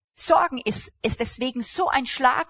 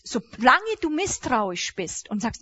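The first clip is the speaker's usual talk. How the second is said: usual speaking voice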